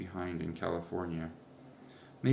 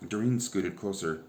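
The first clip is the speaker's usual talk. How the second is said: plain reading voice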